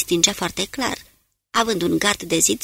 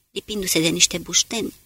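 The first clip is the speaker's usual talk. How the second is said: usual speaking voice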